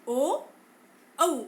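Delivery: ओ, औ with rounded lips; vowel demo. Shown as a demonstration. Teacher demonstrates ओ, औ to the class. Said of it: "ओ और औ का उपयोग करते समय".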